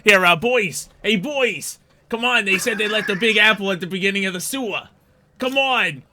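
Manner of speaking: loud, high rat calling his crew; rally the boys at high volume; wiseguy rat. Acted. Rat drops act, rallies mates.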